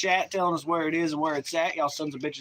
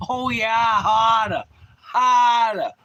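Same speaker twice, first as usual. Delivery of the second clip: loud, high, drawn-out Midwest accent; 8 semitones higher, 7 dB louder, much slower than usual. Shown as a demonstration. Streamer showing how to get into the accent, exaggerated Midwest. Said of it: "that's how you get into that accent".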